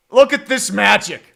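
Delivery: loud ironic admiration; sarcastic praise. Real, not acted. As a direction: jeer at the bad offense. Mocking the team's offense.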